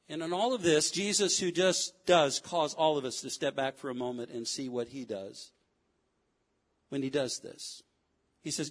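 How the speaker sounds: ordinary talk, nothing marked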